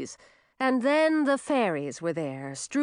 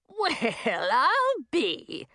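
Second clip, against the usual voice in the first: cackling; 6 semitones higher, much slower than usual. Acted. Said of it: "cackled Madine".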